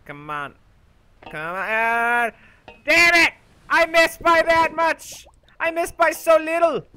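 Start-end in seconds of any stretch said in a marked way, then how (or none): 1.5-6.8 high, loud exasperated yell; frustrated outburst. Real, not acted. Gamer missing a jump by a hair, frustrated yell. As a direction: vent about the near miss.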